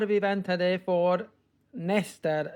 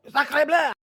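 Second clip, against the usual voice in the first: loud, high exasperated French outburst; hammed-up French "sacre bleu", shouted; French exclamation; 8 semitones higher, 6 dB louder than usual.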